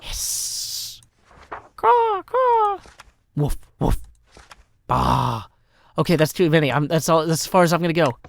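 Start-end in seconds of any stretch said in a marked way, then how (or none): 1.8-2.8 crow caws. Pretend. Making animal noises for timer. 3.4-4.0 dog woofs. Pretend. Making animal noises for timer. 4.9-5.5 sheep bleat. Pretend. Making animal noises for timer.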